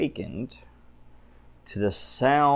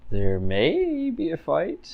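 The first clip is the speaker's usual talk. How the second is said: ominous warning; 6 semitones higher, slower than usual. Acted. Repeats line slow, ominous.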